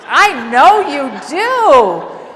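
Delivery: slow, drawn-out loving agreement; tender reassurance. Retold or imitated. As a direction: let him know you understand, slowly. Reassuring patient who likes wives.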